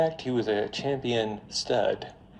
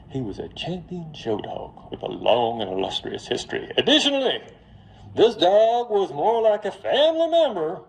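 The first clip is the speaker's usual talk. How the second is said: put-on Mississippi accent; 6 semitones higher, 4 dB louder than usual. Lawyer pleading for the grieving family, hammed-up Mississippi accent. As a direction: play up the Mississippi accent for the jury. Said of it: "it's my Mississippi accent".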